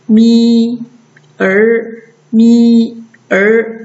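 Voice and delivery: 咪 and 儿 held apart as two syllables; sounds shown separately. Shown as a demonstration. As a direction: sound out 咪, then 儿, before blending. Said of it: "直接加儿一起读".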